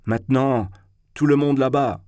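rally the whole company; calling out. A narrator's reading. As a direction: cry it out as an order to set off. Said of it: "cria Zverkov".

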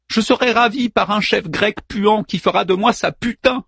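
wife screaming her fate at Hector; screamed. Acted. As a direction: yell it out, high and furious. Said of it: "hurla Andromaque si fort".

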